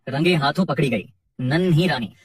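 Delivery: usual speaking voice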